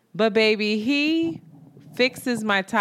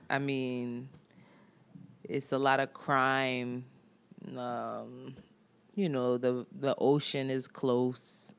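much softer and lower, slow and dragging; mock mumbled reply; 7 semitones lower, 10 dB quieter, slower than usual. Imitating the mechanic's dull answers, very soft. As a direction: mumble it like a bored man. Mimicking his muttered answers.

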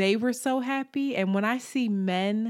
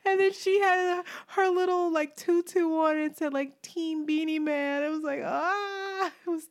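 tearful; 10 semitones higher than usual. Real, not acted. Describing the little girl in a tutu, tearful. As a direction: let the tears break through the words. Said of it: "I'm about to cry now".

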